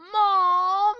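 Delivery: high loud wailing call for mom; bratty kid wail. Acted. Spoiled kid screaming for his mom, wailing. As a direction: wail for mommy like a brat. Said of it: "kid calls his mum".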